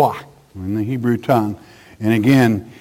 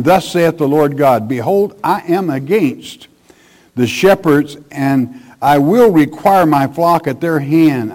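louder, emphatic preacher's Bible reading; forceful scripture reading; 3 semitones higher, 4 dB louder than usual. Acted. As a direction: proclaim the verse from the pulpit. Reads scripture aloud forcefully.

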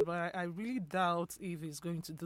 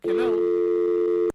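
loud, drawn-out "Hello?"; calling out; 12 dB louder than usual. Real, not acted. Radio host calling loudly to a caller who won't answer. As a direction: call down a dead phone line.

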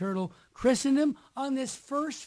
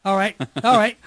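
louder for the mic; 6 dB louder than usual. Real, not acted. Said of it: "I'd go a little bit more".

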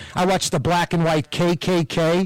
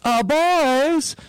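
put-on higher southern accent, boys stretched and rising; 10 semitones higher than usual. Retelling Gene Wilder luring the Klan, southern accent. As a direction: coax them over with a light southern accent. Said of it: "like in a southern accent".

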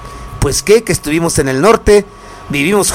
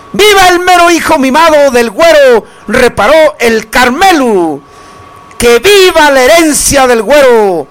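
ringing cheers with stretched names; celebratory cries to the listeners; festive viva shouts; 6 semitones higher than usual. Acted. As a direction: call out toasts like a fiesta announcer. Radio host calling out vivas.

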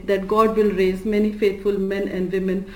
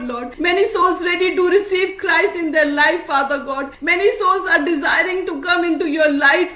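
higher-pitched, urgent prayer voice; praying aloud in a raised pitch, phrase after phrase; fervent prayer; 6 semitones higher than usual. Real, not acted. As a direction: pour out a continuous plea to God. Leads prayer after plain exhortation.

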